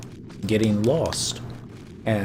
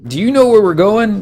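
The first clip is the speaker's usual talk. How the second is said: high, loud mock questioning; raised-voice imitation of lost followers; acted group complaint; 9 semitones higher, 8 dB louder than usual. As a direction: call it out to the leader, hammed up.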